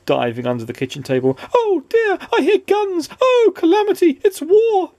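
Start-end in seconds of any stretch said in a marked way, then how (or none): 1.5-2.2 very high, squeaky wail of dismay; panicked cry. A narrator's reading. Mister Jelly diving under the table, panicked cry. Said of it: "cried Mister Jelly". 2.3-4.9 loud, very high hysterical squealing; panicked cry. A narrator's reading. Mister Jelly under the kitchen table, panicked cry. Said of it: "cried Mister Jelly".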